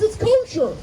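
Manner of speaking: the chief rabbi insisting his oven is kosher, high and upset; upset insistence. Retold or imitated. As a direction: insist hard, stung at being doubted. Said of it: "the chief rabbi gets very upset".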